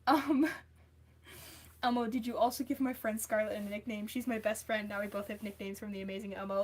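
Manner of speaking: normal talking voice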